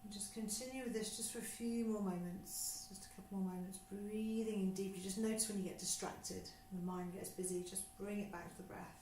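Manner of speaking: guided breathing. Acted. Teacher guiding a class through breathing, calm and unhurried. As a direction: talk them gently through each breath.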